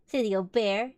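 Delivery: usual speaking voice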